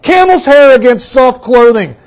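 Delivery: loud, high oratorical reading; loud, sweeping declamation; grand reading. Retold or imitated. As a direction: read it like a Victorian orator. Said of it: "resumes quoted reading".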